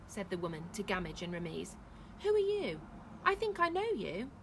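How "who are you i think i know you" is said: high, loud questioning; suspicious challenge. A narrator's reading. Woman to Gamage and Ramiz, suspicious challenge. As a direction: challenge a half-known stranger.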